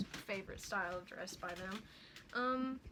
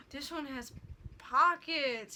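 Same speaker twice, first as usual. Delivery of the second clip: much louder, thrilled; excited; 3 semitones higher, 7 dB louder, slower than usual. Real, not acted. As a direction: blurt it out delighted. Gasps at the pockets.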